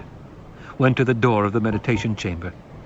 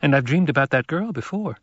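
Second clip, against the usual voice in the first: put-on higher boy's voice, earnest; young boy; 6 semitones higher than usual. Paul answering the Reverend Mother, higher boyish voice.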